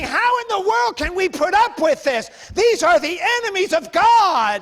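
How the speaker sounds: acted indignant outburst; indignant outrage. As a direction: cry out in righteous disbelief. Acting out young David's outrage.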